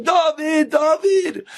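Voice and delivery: high mock holler to wake a son; hammed-up high shout; retold screaming. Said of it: "and he's screaming".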